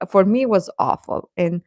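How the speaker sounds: ordinary talk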